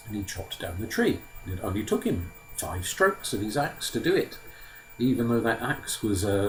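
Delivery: ordinary delivery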